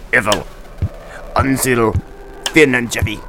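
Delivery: hoarse, eerie stranger's voice; husky rasping voice. Acted. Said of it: "the voice said".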